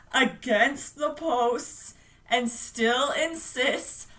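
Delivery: slow, deliberate words; laboured recitation. Acted. Bill reciting against the monster, slow and laboured. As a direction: drag each word out with effort.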